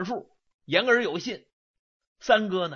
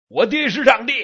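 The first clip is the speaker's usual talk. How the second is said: proud shout; 6 semitones higher, 7 dB louder than usual. Acted. Eldest brother boasts, chest-thumping.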